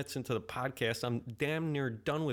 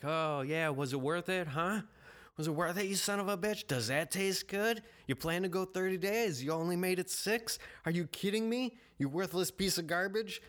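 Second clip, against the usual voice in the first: high, sneering taunt; mocking demon voice; 6 semitones higher than usual. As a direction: jeer at him like a gloating demon. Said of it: "I really like that demon voice".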